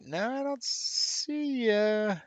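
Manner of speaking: high, very slow lilt; playful drawn-out singsong. Real, not acted. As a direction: stretch it out teasingly while searching. Scanning the list playfully.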